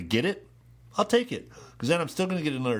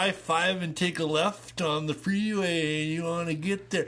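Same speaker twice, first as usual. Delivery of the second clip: higher, exaggerated California accent giving directions; Californians skit impression; 7 semitones higher than usual. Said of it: "You sound like a Canadian".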